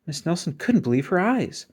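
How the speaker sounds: ordinary delivery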